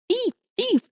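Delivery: high-pitched mock scream; imitated scream. Imagined Karen accusing a stranger, in a high mock scream. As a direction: squeal the accusation without real volume. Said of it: "to start screaming".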